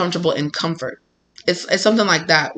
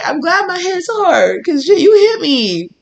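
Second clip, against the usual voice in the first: slow, much higher half-crying whine; mock teary whine; 10 semitones higher, 3 dB louder, slower than usual. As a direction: pout through it, hammed-up. Whiny acted reply to Spirit.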